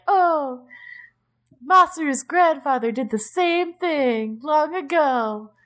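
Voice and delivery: lightly hammed-up nostalgic character; mock wistful. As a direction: drift off like a sentimental elder. Mocking wistful character line.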